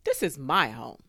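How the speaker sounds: loud, indignant order, starting high; holler, then firmly stand your ground. A narrator's reading. Neighbour defending her home from drone, loud holler. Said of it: "Missus Ramirez hollered".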